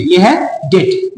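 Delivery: डेट said slowly with the b silent; correct pronunciation. Shown as a demonstration. Teacher showing students the right way, slow and clear. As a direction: drag out डेट with no b sound. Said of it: "इसमें बी silent है".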